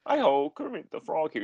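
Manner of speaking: puppet frog impression; Kermit. Podcaster copying co-host's way into the impression, Kermit voice. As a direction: have a go at the frog puppet's greeting. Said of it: "you do better Kermit than I do".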